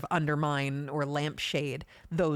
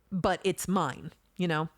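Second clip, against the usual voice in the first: usual speaking voice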